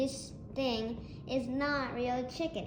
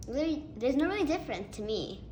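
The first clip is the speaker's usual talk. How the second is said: ordinary talk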